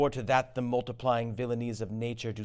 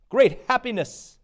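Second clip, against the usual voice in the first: high and loud burst of joy; joyful cry; 11 semitones higher, 6 dB louder than usual. Acted. Ross hails the victory to the king, elated and high. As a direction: cry out the good news, thrilled.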